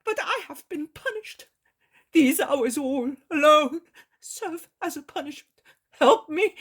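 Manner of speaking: put-on high, tearful, broken old man's voice; Eli, sobbing plea. A narrator's reading. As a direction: grandfather weeps over his dying grandson. Eli breaks down weeping.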